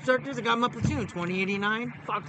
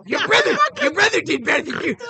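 high, loud imitated berating; retold shouted goading; drill instructor taunt; 8 semitones higher, 6 dB louder than usual. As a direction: yell the unfavorable comparison at a struggling recruit.

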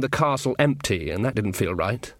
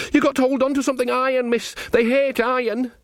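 put-on high-pitched panicky young soldier voice; frightened Sean Ogg; 12 semitones higher than usual. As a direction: blurt it out high, reporting to the queen. Narrator voices frightened Sean in a story.

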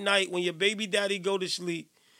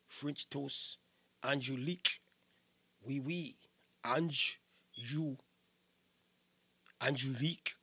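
low, soft mock-French accent; hushed, playful French accent; French pronunciation; 7 semitones lower, 12 dB quieter, slower than usual. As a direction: sound out the name in a careful French accent. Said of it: "French pronunciation".

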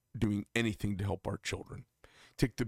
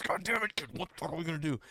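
high, fast, frantic dad voice, swearing in a panic; worked-up dad; 7 semitones higher, faster than usual. Retold or imitated. Son retelling his father's phone call, frantic high fast rant. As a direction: play the panicked father spilling it all out at once. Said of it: "he's really worked up".

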